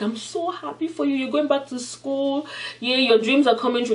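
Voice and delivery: mock gushing in a small high voice; happy cousin. Younger cousin congratulates Tina on school, high happy gushing. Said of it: "The younger cousin was so happy".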